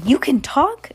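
high, loud shocked question; can't believe the cat spoke; startled. Acted. Max startled that the cat can talk, loud and high. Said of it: "Max gasped".

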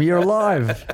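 high mock scream; retold yell, pitched well up; imitating screaming fans. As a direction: call it out high like a fan spotting someone. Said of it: "They were screaming at him".

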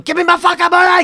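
high, loud furious demand for payment; angry yell. Retold or imitated. Freelancer yelling at a client who won't pay, loud and high. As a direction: yell it like an unpaid freelancer who's had enough. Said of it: "I just end up saying".

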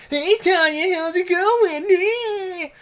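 very high, drawn-out silly voice; creepy mock greeting. Cat-faced villain pops up to greet Tanya, squeaky mock greeting. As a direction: stretch it out in a squeaky singsong.

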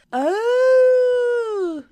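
long high sustained howl; dog howl imitation. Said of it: "kind of like a dog howling".

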